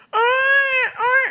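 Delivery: tangisan bayi bernada sangat tinggi; tiruan tangis bayi. Retold or imitated. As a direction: tirukan bayi menangis melengking panjang. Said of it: "Anak nangis". (niru bayi nangis)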